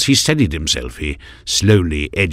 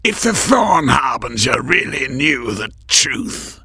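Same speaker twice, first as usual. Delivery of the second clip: rasped; 9 semitones higher than usual. A narrator's reading. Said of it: "the cloaked figure rasped".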